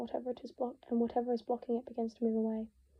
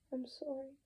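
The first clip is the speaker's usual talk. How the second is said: croaking; 3 semitones higher, 6 dB quieter, slower than usual. Acted. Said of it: "he croaks".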